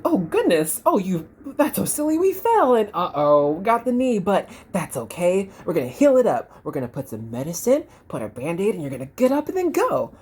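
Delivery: high, bright mock-cheery comforting; cheerful reassuring adult. Adult cheering up the fallen kid, high and upbeat. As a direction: make light of the fall for the kid. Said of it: "you'd be like".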